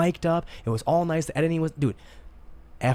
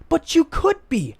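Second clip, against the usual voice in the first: exasperated pleading; 8 semitones higher than usual. Retold or imitated. Pleading with gossiping friends.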